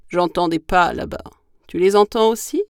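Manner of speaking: loud monster voice, rising question; big creature asking, lifting at the end; gruffalo voice. Acted. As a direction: boom it like a wary beast. Gruffalo asks the mouse.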